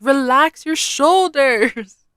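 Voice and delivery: a mock trainer telling her to relax; trainer's reminder. Trainer coaching her client, slow and high-pitched. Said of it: "She's like".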